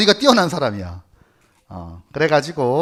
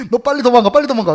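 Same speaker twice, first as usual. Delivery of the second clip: higher, louder urging to flee; urgent warning; 6 semitones higher, 5 dB louder, much faster than usual. Acted. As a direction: hurry him away, voice raised. Mother urging son to flee. (다급하게 재촉하는 엄마 목소리)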